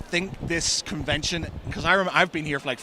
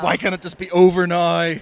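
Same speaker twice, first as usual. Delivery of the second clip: exasperated whine; 4 semitones higher, 8 dB louder than usual. Retold or imitated. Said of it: "you're just like".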